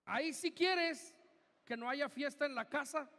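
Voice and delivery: normal talking voice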